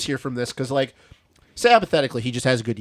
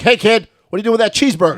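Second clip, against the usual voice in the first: put-on higher, louder PA shout at a kid; announcer calling out; 8 semitones higher, 5 dB louder, faster than usual. Friend mimics the announcer teasing a kid, higher and louder. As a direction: call down from the tower.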